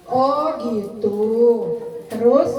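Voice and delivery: slow, calm "Oh, gitu" with a rise; calm listening. Shown as a demonstration. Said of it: "Itu namanya mendengar aktif". (ibu mendengarkan anak dengan tenang dan pelan)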